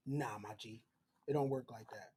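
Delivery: dropped, hushed, menacing voice; low cold denial. Retold or imitated. Anthony refusing to free John, low and hushed. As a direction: shut him down low and soft. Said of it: "Anthony like".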